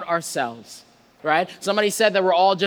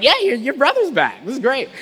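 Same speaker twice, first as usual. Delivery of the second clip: higher, louder cheery servant blurting news; clueless servant; 6 semitones higher, 5 dB louder than usual. Retold or imitated. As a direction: play the servant happily unaware of trouble. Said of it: "so clueless".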